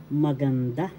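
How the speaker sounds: "maganda" said maganDA, rising on the last syllable; stress on third syllable. Shown as a demonstration. Teacher showing how you pronounce it, "maganda" stressed on "da". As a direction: lift and stress the final "da" of "maganda". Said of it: "Third syllable has the accent".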